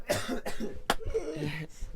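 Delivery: high, strained real coughing; coughing fit. Real, not acted. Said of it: "[name]'s coughing".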